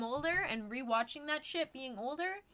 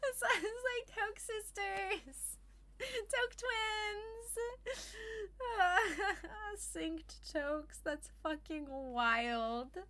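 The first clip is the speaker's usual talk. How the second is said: giggly stoner; 9 semitones higher, 5 dB quieter, much slower than usual. Real, not acted. Giggling over toke joke.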